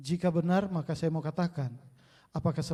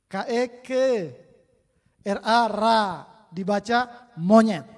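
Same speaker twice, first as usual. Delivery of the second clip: put-on child reading; 3 semitones higher, 8 dB louder than usual. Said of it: "waktu saya ajar baca T K".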